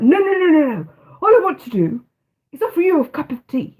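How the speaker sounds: posh English upper-class voice; posh Eton voice. Retold or imitated. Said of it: "In his posh, snobby Eton voice".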